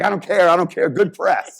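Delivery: loud, high imitation of an excited coach; fired-up coach. Coach cheering a press through the ceiling, imitated fired-up shout. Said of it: "he was just fired up".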